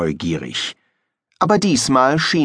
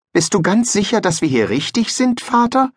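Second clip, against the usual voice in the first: put-on worried boy asking his father, pitched higher; a higher, youthful voice, unsure; young son, higher voice; 11 semitones higher than usual. Son asks his father if they are right, in a higher boyish voice.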